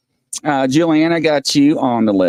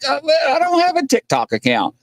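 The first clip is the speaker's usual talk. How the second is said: quoting Peter; 13 semitones higher than usual. Retold or imitated. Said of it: "And Peter's like".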